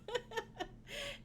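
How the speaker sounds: high, soft giggle; short amused giggle; laugh. Real, not acted. Tickled by the yucky punchline, laughing.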